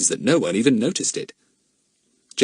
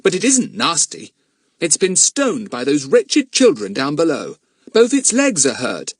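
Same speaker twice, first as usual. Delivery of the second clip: put-on earnest boy; 9 semitones higher, 5 dB louder than usual. Philip defends monkey.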